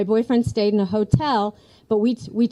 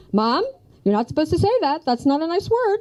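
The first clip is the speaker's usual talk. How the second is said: scolding son; 7 semitones higher than usual. Retold or imitated. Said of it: "He says".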